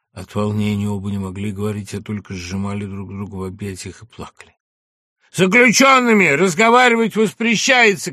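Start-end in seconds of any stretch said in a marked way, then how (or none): 5.3-8.1 gendarme's shout. Acted. Gendarme orders prisoners apart.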